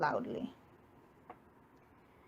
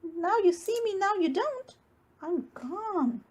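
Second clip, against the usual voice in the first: higher, teasing sing-song mouse; playful little mouse; 7 semitones higher than usual. A narrator's reading. Mouse teasing bear as he leaves, higher voice. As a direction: tease bear lightly as you vanish.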